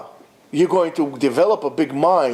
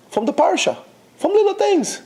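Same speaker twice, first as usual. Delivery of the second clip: from little things, pushed up high; a high stressed plea to the congregation; emphatic point; 12 semitones higher than usual. Real, not acted.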